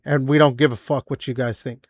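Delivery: ordinary talk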